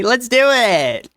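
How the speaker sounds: high, slow, drawn-out cheer; excited. Real, not acted. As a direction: stretch it out, gleeful and high. Excited agreement to stream plan.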